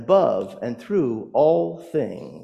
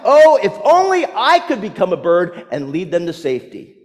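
high, loud outburst easing toward the end; frustrated man's raised-voice lament; frustrated yell; 6 semitones higher, 7 dB louder than usual. Acted. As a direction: cry out in exasperation, acting it out. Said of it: "in his frustration, yelled out".

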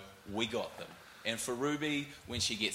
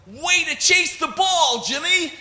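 high, loud, slow cheer from the stands; cheering yell; 10 dB louder, slower than usual. Retold or imitated. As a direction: yell it like a proud Southern parent. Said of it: "they just yelled".

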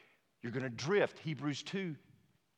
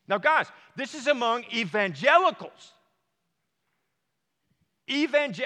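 much higher and louder, almost shouting; exasperated outcry; 11 semitones higher, 11 dB louder than usual. Real, not acted. Pastor to congregation, outraged shout over survey. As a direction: cry out in outrage at the church.

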